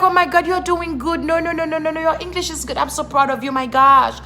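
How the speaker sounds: high-pitched mock enthusiasm; gushing praise. People praising a newcomer's broken English, mock gushing. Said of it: "They're like".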